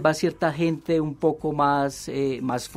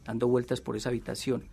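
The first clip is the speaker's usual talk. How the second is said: measured novel reading, softer than the chat; story narration; 5 dB quieter, faster than usual. A narrator's reading. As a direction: read the confession aloud as a storyteller. Reads novel excerpt aloud.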